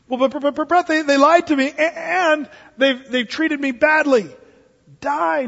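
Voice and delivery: high, pleading stammer; mock wounded whine; whining objector. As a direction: sputter like a hurt complainer. Objector voiced again.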